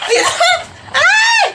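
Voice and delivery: very high, loud scream; roller coaster scream. Acted. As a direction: shriek as the ride drops. (matinis na sigaw sa roller coaster)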